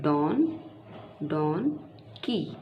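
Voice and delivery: डॉन then की, as two parts; teacher splitting a word for spelling; split into syllables. Shown as a demonstration. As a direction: break डोंकी into डॉन and की. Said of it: "दो parts में divide कर सकते हैं".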